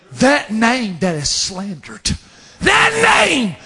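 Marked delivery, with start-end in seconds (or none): 2.6-3.6 drawn-out cry on a repeated phrase; emphatic preaching cry. Real, not acted. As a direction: cry it out to the congregation, stretched.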